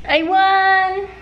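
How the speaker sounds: high, loud victory shout; excited cheer. Real, not acted. Just won card game.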